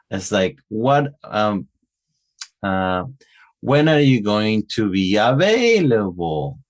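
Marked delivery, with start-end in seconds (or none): 3.6-6.6 WH-question tune, AVAILABLE rising and dropping; up-and-down question intonation. Shown as a demonstration. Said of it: "that's how the intonation, uh, uh, goes up".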